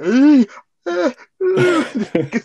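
very high, loud imitated squeaks; shrieking bat squeals. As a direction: mimic a cornered creature's piercing shrieks. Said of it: "starts making loads of like fucking weird noises".

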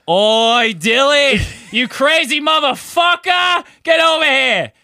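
loud, high Australian shout across the lobby; Australian accent. Retold or imitated. Aussie strangers calling him over across the lobby, Australian shout. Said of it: "your Australian accent got really good".

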